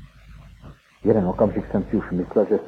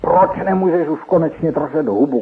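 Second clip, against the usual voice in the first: loud, higher shout; 8 semitones higher, 5 dB louder than usual. A narrator's reading. Nicky shouting at Izrael pinning him down, loud. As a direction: yell it in a rage. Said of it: "křičel jsem pořád znovu".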